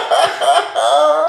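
high loud cackle; loud burst of laughter. Real, not acted. Laughing at the dashiki group chat mix-up, a loud burst of laughter. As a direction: break out in real laughter. Reacting to girlfriend's message.

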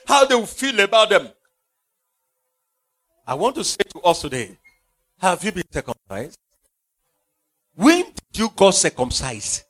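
usual speaking voice

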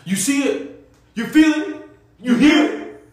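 high, loud rising hype calls; hammed-up wrestler hype chant; Big E hype. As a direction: fire up the crowd like a wrestler. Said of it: "just like I said".

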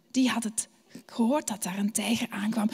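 soft breathy whisper, tense; hushed whisper. A narrator's reading. Storyteller whispering as the sheep hears the tiger. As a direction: whisper it like danger is near.

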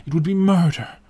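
high, loud, horrified exclamation; impassioned outcry; crying out. A narrator's reading. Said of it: "he cried".

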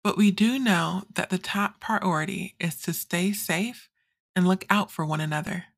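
ordinary delivery

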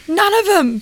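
mock yell, pitched well up; retold yell back. Partner quoting his yelled reply across the training lap, mock yell. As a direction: imitate him calling back, only half shouted. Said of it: "he just yells back".

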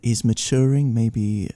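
measured, halting mock British accent. Cigar reviewer describing subtle notes, calm British accent. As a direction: pause over each note like a British connoisseur. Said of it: "you sounded just like [name]".

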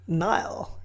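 ordinary talk, nothing marked

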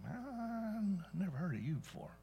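lightly voiced devil sneering at pretenders; the devil scoffing. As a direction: brush them off the way the devil would. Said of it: "the devil's gonna look at them and say".

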